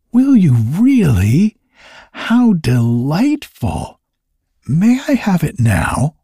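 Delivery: high, eager rush of delight; thrilled child asking for a treat. A narrator's reading. Toinette offered the magic fern seed, delighted and eager.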